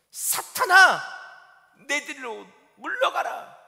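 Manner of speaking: loud sharp cry, then slow command; Jesus sharply rebuking Peter; stern rebuke. Acted. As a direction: rebuke him forcefully.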